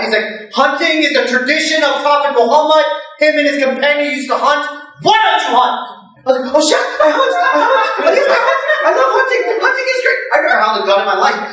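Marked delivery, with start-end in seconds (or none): 0.5-5.8 high-pitched, insistent lecturing; high, emphatic challenge to a guest; indignant chef. Acted. Gun-owning chef scolds his guest. 6.5-10.2 very high, hammed-up nervous gushing; comic panic, far above usual pitch; panicked agreeing. Scared guest pretends he loves hunting.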